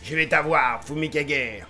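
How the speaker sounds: low, quiet scheming villain voice; a lightly done movie villain taunt. Retold or imitated. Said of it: "il a une voix de méchant".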